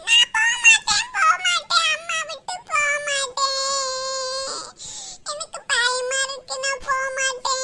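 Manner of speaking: bawling child's protest, acted; tearful child refusing. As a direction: wail like a small child digging in. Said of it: "அழுக கூடாது".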